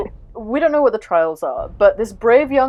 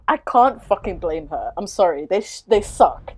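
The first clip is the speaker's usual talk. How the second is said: normal talking voice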